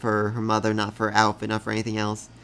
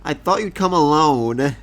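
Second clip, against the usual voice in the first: pouting; 3 semitones higher, 4 dB louder than usual. Retold or imitated. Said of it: "The Demon Lord is literally pouting".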